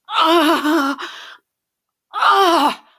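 high, loud ecstatic screams; long, piercing cries; screams of pleasure. Acted. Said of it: "my screams of pleasure".

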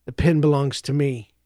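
low, soft and inward, almost to himself; quiet. A narrator's reading. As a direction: murmur it quietly like a private vow. Joseph mutters to himself.

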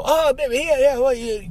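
hammed-up agreeing with his girlfriend; fawning boyfriend. Mocking a man who gives in.

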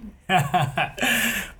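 a bright high burst of laughter. Real, not acted. Laughs at zen remark.

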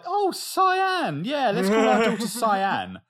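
high, loud mock enthusiasm; hammed-up delight at a bright idea; gushing parents. Imitating parents choosing their daughter's name, mock gushing. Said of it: "they were like".

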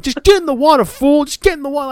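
high, loud mock bark; impatient Santana. Santana telling his partner to jump in: high mock bark. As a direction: order him in, fed up. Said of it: "Santana's like".

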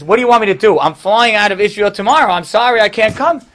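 wealthy man protesting he flies out tomorrow; exasperated protest. Retold or imitated. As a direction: throw up your hands and protest. Man pleads he can't visit.

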